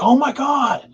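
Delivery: high-pitched mock freak-out; imitating friends going crazy. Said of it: "I hear 'em just going crazy".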